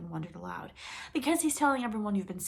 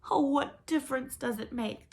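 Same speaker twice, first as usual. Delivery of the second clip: wailing; 7 semitones higher, 5 dB louder than usual. Acted. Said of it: "the girl wailed".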